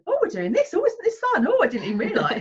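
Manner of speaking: high, overexcited singsong cheer; mock excited parent. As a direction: sell the fun like a kids' show host. Parent faking excitement to distract child.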